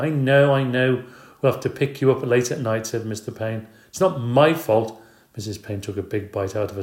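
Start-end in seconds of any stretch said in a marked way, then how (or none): 0.0-2.9 higher, louder, fed up; husband impatient with the repeated news; impatient I know. Acted. Husband cuts her off. 3.9-5.0 slow, higher, louder; wife defending herself; defensive snap. Acted.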